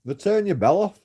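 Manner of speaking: angry retort. Retold or imitated. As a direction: shout back in frustration. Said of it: "And I'm like".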